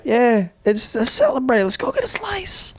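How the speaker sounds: high, peppy mob boss; chirpy mobster pushing team bonding; cheesy mafia boss. Acted.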